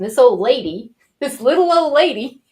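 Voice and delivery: loud mock amazement, climbing high; imitated amazed kids. High school kids marvelling at her, loud mock amazement. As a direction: marvel loudly, building to a high pitch. Amazed kids' thought, acted out.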